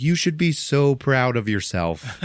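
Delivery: high, slow sincere praise; earnest praise. Acted. Diana praising the ice cream vendor, high and slow in earnest. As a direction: heap earnest praise on him. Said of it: "She's like".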